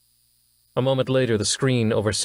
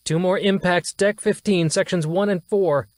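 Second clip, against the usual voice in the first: high, urgent, strained officer voice; alarmed officer; 10 semitones higher than usual. Acted. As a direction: report the damage in a rising panic. Officer reporting hits.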